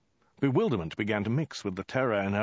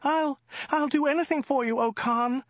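high falsetto, pleading; hammed-up high-pitched begging; stammering frightened woman; 3 dB louder than usual. A narrator's reading. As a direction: stammer in a put-on woman's squeak. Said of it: "stammered the woman".

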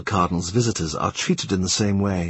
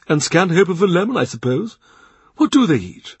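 higher, louder indulgent host; the Cardinal's offer; 9 semitones higher, 3 dB louder than usual. A narrator's reading. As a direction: ask like a generous prince. Cardinal teasing Cromwell.

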